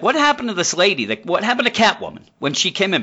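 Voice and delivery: high, fast demanding question; boss demanding. Retold or imitated. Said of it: "he's like".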